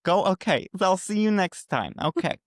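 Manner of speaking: put-on high, urgent motherly voice hurrying the kids along; a frantic mother shooing children out the door; Marisol voice. Mother hurrying students out, high and urgent. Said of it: "her normal Marisol voice".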